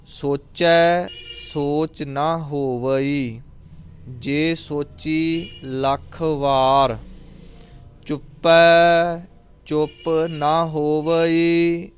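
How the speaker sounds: very slow, sustained chant with long vowels; scripture recitation. Shown as a demonstration. Teacher reciting Japji for students, slow measured chant. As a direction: draw out each line on a level, held tone.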